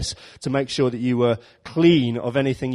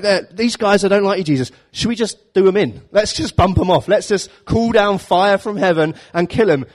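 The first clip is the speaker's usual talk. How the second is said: higher, louder, keen and pushy; eager hotheads; 7 semitones higher, 5 dB louder than usual. Acted. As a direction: egg Jesus on, hungry for action. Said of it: "James and John are like".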